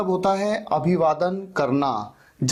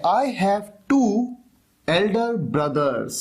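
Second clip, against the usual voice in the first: slow model sentence; much slower than usual. Shown as a demonstration. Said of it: "तो आप कहते हैं".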